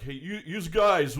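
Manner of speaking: loud, slow "yous guys" in a hammed-up Northern accent; mock northern accent. Mocking how Northerners talk, in a loud Northern accent. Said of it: "everybody up here is like".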